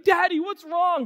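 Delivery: slow, high-pitched worry for dad; concerned children. Retold or imitated. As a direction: plead like a concerned kid. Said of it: "my children are, like, concerned".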